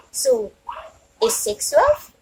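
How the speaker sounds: high playful quiz voice; mock surprise. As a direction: tease the kids with a squeaky question. Teasing question to small kids.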